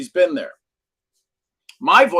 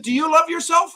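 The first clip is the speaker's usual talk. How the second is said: mentor pressing; 5 dB louder than usual. Retold or imitated. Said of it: "he says, I said".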